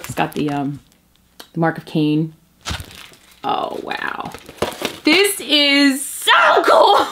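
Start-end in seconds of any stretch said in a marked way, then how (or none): 5.0-7.1 high, slow thrilled exclaim. Real, not acted. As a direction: savor every word in awe. Awed exclaim at print.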